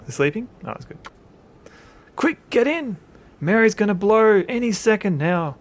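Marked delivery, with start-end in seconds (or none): 2.1-5.6 high, loud urgent shout. Acted. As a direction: shout to hurry people aboard. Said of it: "Owen Cowder shouts from the helicopter".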